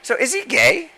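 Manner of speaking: high put-on mocking voice; a teasing squeaky voice. Friends mocking a fake voice, high put-on voice.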